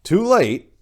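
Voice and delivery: plain reading voice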